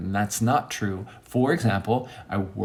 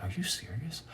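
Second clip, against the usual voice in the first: hushed, concerned question; imitating a friend's quiet concern; 5 semitones higher, 13 dB quieter than usual. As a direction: ask softly, as a worried friend. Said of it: "he was concerned".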